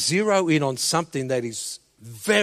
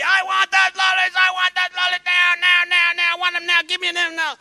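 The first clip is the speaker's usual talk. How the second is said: much higher, louder tantrum scream; screaming kid; 14 semitones higher, 7 dB louder than usual. Retold or imitated. Boy screaming for lollies at the dairy section. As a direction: scream it like a child in full tantrum. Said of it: "jumping and screaming uncontrollably".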